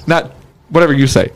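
usual speaking voice

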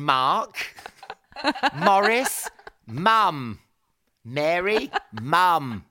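mock calling to a voice assistant; repeated names in a comic voice-command call. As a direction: call each name out separately, half laughing. Exasperated retake of hands-free commands.

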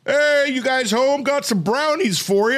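high, loud, drawn-out hail; hammed-up high neighborly voice; jolly neighbor impression. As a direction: call out like an over-friendly neighbor. Imitates neighbor offering brownies.

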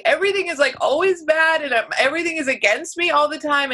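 high, mocking angsty young self; mock angsty twenty-something; whiny twenties self. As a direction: imitate your dramatic younger self. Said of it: "in my twenties I was like".

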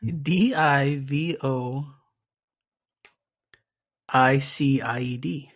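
slow letter by letter; spelling it out. Shown as a demonstration. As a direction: spell each letter out slowly. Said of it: "spelling bee".

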